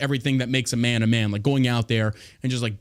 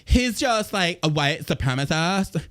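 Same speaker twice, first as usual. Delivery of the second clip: exaggerated camp voice, drawn out at the end; 4 semitones higher, slower than usual. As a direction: start high, then stretch the words in camp parody.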